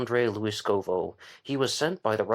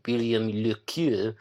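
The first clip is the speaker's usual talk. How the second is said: put-on French pronunciation of the name; fake French accent; much slower than usual. Shown as a demonstration. Podcaster showing how he could say the author's name, fake French accent. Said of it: "do the fake French accent".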